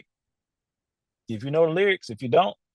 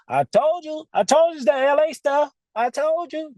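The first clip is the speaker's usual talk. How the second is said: high, told-you-so whine; high gloating I-told-you-so; old self nagging; 14 semitones higher than usual. Retold or imitated. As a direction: voice your petty inner skeptic. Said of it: "that's how he sounds sometimes".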